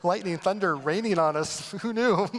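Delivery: high, giggly, dreamy lovestruck voice; hammed-up couple in the rain; mock lovers giggling. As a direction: coo it through little laughs. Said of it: "Smiling at each other".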